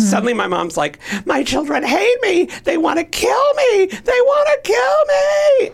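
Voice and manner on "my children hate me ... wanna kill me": high wailing shriek, building and stretching out; mother's hysterical scream. Retold or imitated. Teller acting out mother accusing children before police, hysterical scream. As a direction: imitate a mother crying out as a victim, climbing to a piercing scream. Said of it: "She's screaming the whole time".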